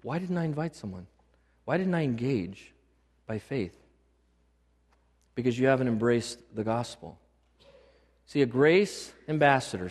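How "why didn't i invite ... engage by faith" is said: softly, as if to himself, much quieter; muttered inner regret on seeing others' guests; quiet imagined churchgoer wondering why he invited no one; hushed regretful self-question. Pretend. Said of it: "and go".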